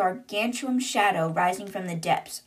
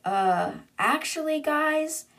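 drawn-out hesitation, then pitch lifts high; stall, then raise it like an uneasy question; hesitant warning; 7 semitones higher, slower than usual. Retold or imitated. Cooper breaks bad news to the others, hesitant warning.

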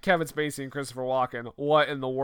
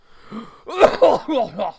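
theatrical gasp; 6 semitones higher, 7 dB louder than usual. Acted. Dramatic gasp.

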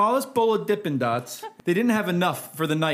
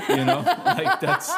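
breaking into high laughter mid-sentence; can't hold it together, laugh through the words; real giggling fit while telling the story; 3 dB louder than usual. Real, not acted.

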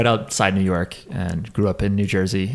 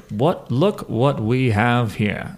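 put-on slow, drawn-out old sportscaster announcing; lightly voiced old-time TV sportscaster, stretching each word; a hint of a vintage boxing-match announcer, slowed down; Howard Cosell; slower than usual. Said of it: "Like that kind of voice".

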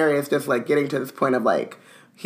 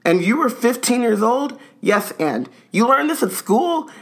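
high, amazed questioning; astonished; 7 semitones higher than usual. A narrator's reading. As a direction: keep the astonishment climbing.

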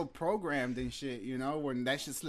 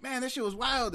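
excited YouTuber; 10 semitones higher than usual. Retold or imitated. Imitating YouTubers saying the slang.

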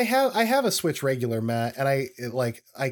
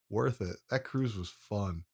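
normal talking voice

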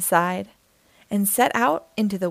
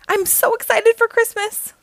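bright gushing squeal, much higher and louder; excited; 12 semitones higher, 4 dB louder than usual. Acted. Oxpecker squealing to Jim, thrilled about Christmas. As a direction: bubble over with holiday joy.

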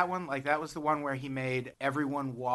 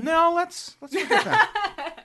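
mock dismissive; 5 dB louder, slower than usual. Mock dismissing Fort Minor.

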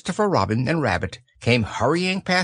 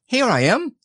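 put-on high little child's pipe; squeak; 11 semitones higher, 3 dB louder than usual. A narrator's reading. Roo turns up for Eeyore, squeaking. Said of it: "squeaked Roo".

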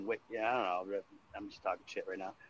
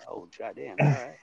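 ordinary delivery

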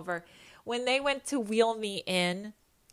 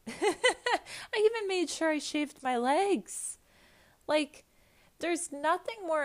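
high, drawn-out words after a giggle; laughing; 9 semitones higher than usual. Real, not acted. Admitting the embarrassing hospital prep, laughing and high. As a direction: laugh your way into it, pitched high.

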